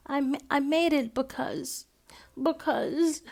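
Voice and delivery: high, halting stammer; boy nervously confessing to grandma. A narrator's reading. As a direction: stumble over the confession.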